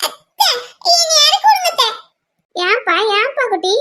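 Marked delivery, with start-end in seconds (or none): 0.1-1.9 put-on high, crying child's plea; whimpering appeal to an aunt; tearful pleading. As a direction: beg through tears to be let down. Upset child begs to be put down.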